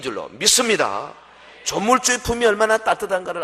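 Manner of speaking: loud preacher's conviction, rising to a cry; preaching. Acted. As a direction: preach it out with full force. Sermon at full force. (힘차게 외치는 설교)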